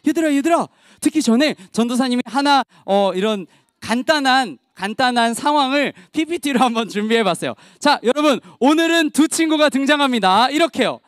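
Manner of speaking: ordinary delivery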